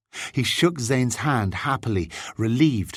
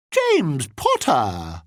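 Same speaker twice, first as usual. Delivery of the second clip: put-on slow, drawn-out, ridiculous English accent; mock English accent; 5 semitones higher, much slower than usual. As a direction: ham up a bad English accent, higher and stretched out. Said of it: "fairly ridiculous attempt at an English accent".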